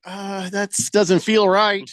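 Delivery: acting out uneasy reluctance; mock uneasy doubt. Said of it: "you're like".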